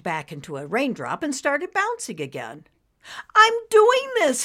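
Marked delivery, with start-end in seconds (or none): 3.3-4.4 put-on very high, loud delighted childlike shout; joyful yell. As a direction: yell for pure joy. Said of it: "he yelled with joy".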